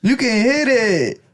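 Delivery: put-on high, drawn-out Mexican accent. Retelling the comedian's joke, high Mexican accent. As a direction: stretch it out in a playful Mexican accent. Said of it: "I love doing Mexican accents".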